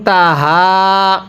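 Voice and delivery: ফাং তাহা with long nasal hold; drawn ghunnah. Shown as a demonstration. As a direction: hum the nasal, then slow তাহা. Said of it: "গুন্নাহ করব".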